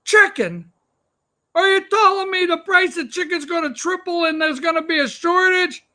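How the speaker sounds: high, aghast preacher voice; a hint of a shocked preacher; mock Baptist preacher. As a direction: play an outraged preacher lightly. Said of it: "They're like".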